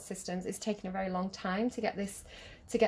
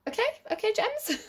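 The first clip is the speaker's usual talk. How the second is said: sudden very high, louder, playful squeak; silly squeaky voice; 13 semitones higher, 3 dB louder than usual. Real, not acted. Tarot reader teasing her Gemini viewers, silly high squeal. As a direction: pop up into a goofy high voice at the viewers. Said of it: "I don't know why I'm talking like that".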